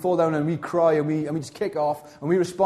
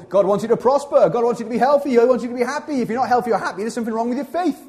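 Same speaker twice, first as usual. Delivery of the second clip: mocking prosperity preacher; 6 semitones higher, faster than usual. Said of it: "But they say".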